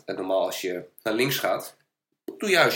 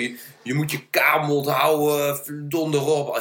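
drawn-out, higher mock scolding; retold swearing; 5 semitones higher, 3 dB louder, slower than usual. Cyclist imitating the kids swearing at him, mock scolding. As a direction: imitate a mouthy kid telling someone off. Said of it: "Begonnen ze heel erg mee te schelden".

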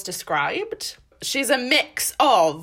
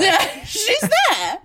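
laughing; 9 semitones higher, 5 dB louder, slower than usual. Acted. Joke continued, laughing.

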